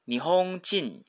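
slow Japanese 日本人, nihon-JI-n, accented on the ji; teacher's drawn-out model of 日本人 as "nihonJIN", pitch accent on ji. Shown as a demonstration. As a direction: stress the ji when reading 日本人 as Nihonjin. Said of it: "它的重音呢是在基上面".

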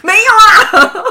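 high, slow protest through laughter; no, laughing and stretching it out; laughing denial. Real, not acted. Denies being a violent mom.

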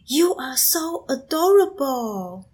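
high, slow, gushing English as mom; imitating mom's English. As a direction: coo it slowly at a little child as mom would. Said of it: "突然爆出了一句英文单词". (模仿妈妈慢慢用英文夸小孩)

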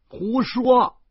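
loud, high-pitched protest; indignant yell. A narrator's reading. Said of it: "我嚷嚷着". (高声嚷嚷)

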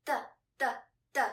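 "The, the, the", each short and separated; short fifth tone. Shown as a demonstration. Teacher modelling the first word to repeat, short and fast. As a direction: cut each "the" off quickly on the beat. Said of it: "Repeat after me for two times".

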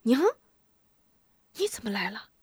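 hushed, startled question; startled boy. Acted. Boy startled by his mother, hushed surprise. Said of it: "吴忠一惊".

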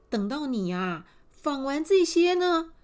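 bright bargaining queen voice; coaxing queen. Acted. Queen promising her son if the girl spins it all, coaxing. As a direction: dangle the prince as a prize. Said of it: "皇后就说啦".

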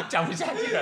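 high, giggling words; storyteller gives up, laughing. Real, not acted. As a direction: say it while still laughing.

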